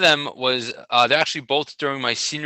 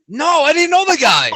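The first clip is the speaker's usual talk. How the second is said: high, loud, laughing denial; laughing indignant outburst; comic protest; 14 semitones higher, 7 dB louder than usual. Retold or imitated. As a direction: protest in mock outrage, voice shooting up. Comic protest at announcer's claim.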